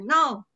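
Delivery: loud NO going down; falling tone. Shown as a demonstration. Teacher showing fourth tone, angry falling NO. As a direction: stamp out NO with a downward drop. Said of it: "So going down".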